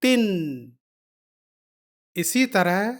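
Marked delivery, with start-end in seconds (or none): 0.0-0.2 تن said "tin", with the n held; short "i", then a long humming n in تن; sound demonstration. Shown as a demonstration. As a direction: model تن as the blended syllable "tin", sustaining the n. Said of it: "مل کر ہوا".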